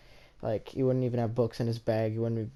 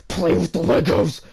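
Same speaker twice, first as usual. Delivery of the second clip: yelled, higher and much louder; mock bully yell, louder and higher; angry shout; 6 semitones higher, 9 dB louder than usual. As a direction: bark the order like a bully. Bully demands they play.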